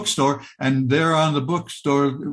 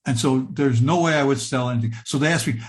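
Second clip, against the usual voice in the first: ordinary delivery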